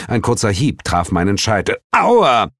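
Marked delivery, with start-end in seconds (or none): 1.9-2.5 high, loud "ow"; startled yelp of pain. Acted. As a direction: cry out from a sudden smack. Hit on the head, cries out.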